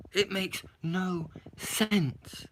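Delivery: very slow, dragged-out disbelief; slow exasperated verdict. Real, not acted. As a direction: spell it out slowly, fed up.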